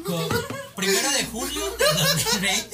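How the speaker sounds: laughing. Acted. Mock date announcement.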